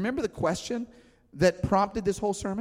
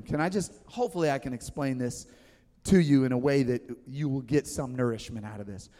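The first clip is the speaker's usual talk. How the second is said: ordinary talk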